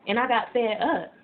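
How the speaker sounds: ordinary delivery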